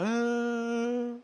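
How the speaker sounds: put-on child's whimper. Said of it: "Daqui a pouco começou a chorar".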